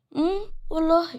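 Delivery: high, soft mocking "really"; girl mocking Adam's threat, light and high; teasing girl. Girl answers the dare teasingly.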